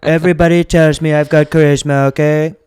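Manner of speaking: loud, high mock Trump boast; hammed-up Trump voice; Trump impression. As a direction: puff up like Trump bragging.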